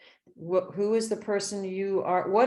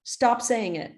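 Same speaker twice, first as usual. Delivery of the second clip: quoting mentor; 5 semitones higher, 3 dB louder than usual. Retold or imitated. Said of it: "my mentor said to me".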